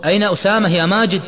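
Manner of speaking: high, loud demand; insistent question. A narrator's reading. Imad questions silent Majid, loud and insistent. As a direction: press hard for an answer. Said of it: "كرر عماد سؤاله مرة أخرى".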